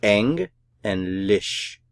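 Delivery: "eng" and "lish" said slowly as separate syllables; "eng and lish" taken apart slowly, with a German open e. Shown as a demonstration. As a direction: draw out "eng", pause, then "lish". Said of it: "The syllabification for this word".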